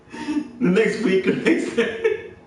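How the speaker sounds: barely getting it out for laughing; talking through a laughing fit. Real, not acted. Still laughing at the image.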